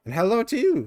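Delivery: high, bright little sprite voice; chirpy little creature. Acted. Narrator voicing a tiny mite greeting the traveler, squeaky. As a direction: pipe it up like a tiny fairy creature.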